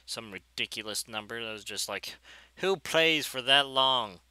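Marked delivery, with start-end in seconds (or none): 2.6-4.2 loud, slow incredulous question; drawn-out, louder, baffled reaction; mock disbelief. Quotes own disbelieving reaction.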